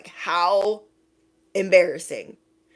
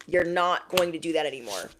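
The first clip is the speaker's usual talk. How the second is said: ordinary talk, nothing marked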